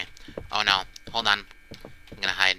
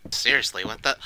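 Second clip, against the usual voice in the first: normal talking voice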